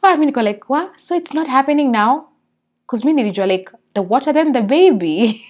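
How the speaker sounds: loud. Retold or imitated. Excited retelling, disbelief.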